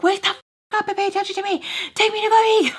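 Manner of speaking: put-on high, fast childish demand; imagined outburst at her sleeping mom; pretend tantrum. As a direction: whine it like a furious little kid.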